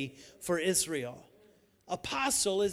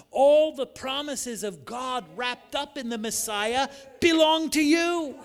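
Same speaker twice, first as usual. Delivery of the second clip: rising to a high, much louder shout on belong to you; preaching climax; 5 semitones higher, 5 dB louder than usual. Acted. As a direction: build and burst on the promise.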